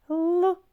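high, loud Л sound; sound demo. Shown as a demonstration. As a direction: sound out the Л for the class. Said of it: "Звук".

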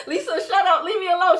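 laughing. Real, not acted. Laughing at a viewer's comment.

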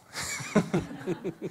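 short unvoiced laugh; breathy laugh. Real, not acted. Coach laughs at his own joke to the class, breathily.

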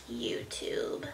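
YouTube breathed out on a sigh; sighed whisper. Real, not acted. Deleting her favourite app, whispered sadly on a sigh. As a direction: let the word go in a sad sigh.

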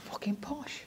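high, breathy whisper; whispered retort. Retold or imitated. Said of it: "I'm like".

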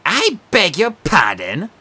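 annoyed. Acted. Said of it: "clearly annoyed now".

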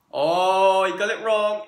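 excited. Real, not acted. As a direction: crow over his mistake. Friend loses round.

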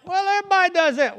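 very high, rushed mimicked excuse; mock excuse. Preacher imitating young people excusing their cussing, high mock excuse. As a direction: toss it off fast in a high mocking voice.

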